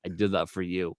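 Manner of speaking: low, soft aside to fans; retold confiding reply. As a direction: drop low and quiet, as a private favour. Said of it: "I'm like".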